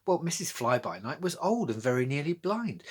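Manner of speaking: ordinary delivery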